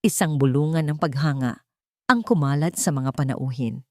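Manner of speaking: ordinary delivery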